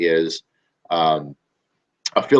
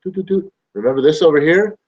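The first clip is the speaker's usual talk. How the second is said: playful reminder; 8 semitones higher than usual. Retold or imitated. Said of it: "And then you go".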